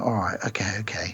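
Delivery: soft whispered okay, trailing off; whispered reply. Retold or imitated. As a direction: hush it, calming him down. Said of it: "she's like".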